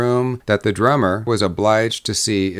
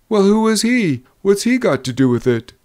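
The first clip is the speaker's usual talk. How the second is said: high question, then a quicker one; agitated drummer; 10 semitones higher, 3 dB louder than usual. A narrator's reading. Drummer asking about Jack Potter, agitated. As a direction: press for answers, starting high.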